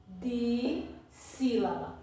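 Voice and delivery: "Dissílaba" slowly, di, pause, sílaba; broken into parts. Shown as a demonstration. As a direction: repeat "Dissílaba" slowly in two pieces for pupils. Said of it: "presta atenção".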